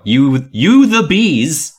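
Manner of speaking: slow mock French. Shown as a demonstration. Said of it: "you is vous".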